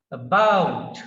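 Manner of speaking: "About" with a loud, falling BOUT; brief light a in "About", then drive into BOUT; stress on second syllable. Shown as a demonstration. Teacher models for practice, "About" stressed on BOUT. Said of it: "stress is on the second syllable".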